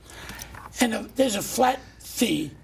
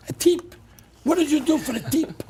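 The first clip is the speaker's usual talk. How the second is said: mock-indignant cab driver, higher; 6 semitones higher, 4 dB louder than usual. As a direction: play the driver's indignant disbelief. Retold cab driver's indignant reply.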